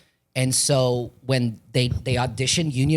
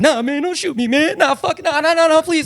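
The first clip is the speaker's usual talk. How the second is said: much higher, desperate begging; pleading, crying; 4 dB louder than usual. Acted. Actor at an audition pleading at gunpoint, near tears. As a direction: beg for your life with tears in your voice. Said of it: "I start crying".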